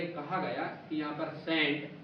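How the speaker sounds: ordinary talk, nothing marked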